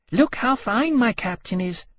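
high, slow, gloating dragon; boastful. A narrator's reading. She-dragon flaunts her captain to her rival, boastful. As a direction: show off in a high, drawn-out voice. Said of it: "to heap on coals of fire".

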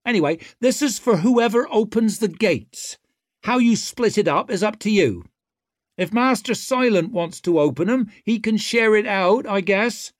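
high, cocky and loud; swaggering rogue taunting; brash young woman. A narrator's reading. Ricker voice.

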